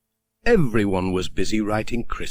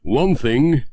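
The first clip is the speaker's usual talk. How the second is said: louder, higher cheery villager; a jokey aside, pitched up; Ted's voice; 4 semitones higher, 5 dB louder than usual. Acted. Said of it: "he said".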